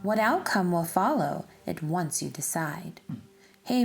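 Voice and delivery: usual speaking voice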